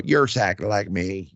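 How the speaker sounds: put-on quoting his psychic friend in a mild Arkansas accent; Cindy's Arkansas accent. Retelling what his psychic mentor told him, in a lightly put-on Arkansas accent. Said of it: "you know, in the Arkansas accent".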